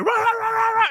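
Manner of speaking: high-pitched mock barking; rapid imitated barks; barking dog. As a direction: imitate a yapping cartoon dog. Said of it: "the dog's like".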